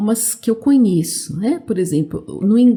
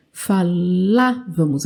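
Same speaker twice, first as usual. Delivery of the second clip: "Falávamos" slow and lower, with a long LÁ; stress on LÁ; 4 semitones lower, slower than usual. Shown as a demonstration. Teacher pointing to the written accent, stress on LÁ. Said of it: "aqui tem até o acento".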